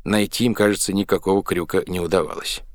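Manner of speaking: ordinary delivery, nothing marked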